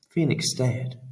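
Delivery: plain reading voice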